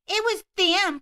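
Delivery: hammed-up furious mom; entitled Karen yelling.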